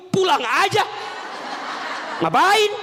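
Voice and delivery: ketus, lebih tinggi dan lebih keras; bentakan suami. Retold or imitated. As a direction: bentak istri dengan kesal. Suami biasa membentak istri. (bentakan suami yang kesal)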